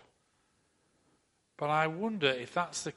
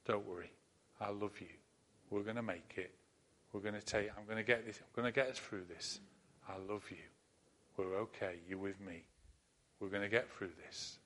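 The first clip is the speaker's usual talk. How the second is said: soft, low, tender reassurance; soft whisper to a son; 3 semitones lower, 7 dB quieter than usual. A narrator's reading. A dad whispering to his scared little son, soft and tender. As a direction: hush a scared child gently. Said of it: "he kept whispering to his little son".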